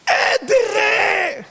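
high-pitched, long stretched exclamation; drawn-out cry. Acted. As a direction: cry it out high and hold the sound. Preacher cries out a drawn-out exclamation. (קריאה גבוהה ומתמשכת)

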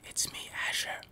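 soft unvoiced whisper; ASMR whisper. Shown as a demonstration. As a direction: breathe it out in a whisper. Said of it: "How is it for A S M R?".

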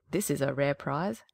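put-on low, gruff old peasant woman. A narrator's reading. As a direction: speak low like a half-blind old woman. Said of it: "she said".

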